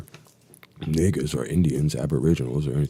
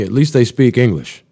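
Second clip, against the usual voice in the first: louder, higher mock cheer; sarcastic exclaim; 9 semitones higher, 10 dB louder than usual. Real, not acted. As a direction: throw out the line as a loud sarcastic jab.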